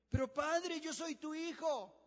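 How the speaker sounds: high, loud pleading cry to his father; pleading son. Acted. Son begs his old father for the blessing, high pleading voice.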